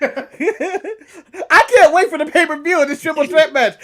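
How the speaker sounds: normal talking voice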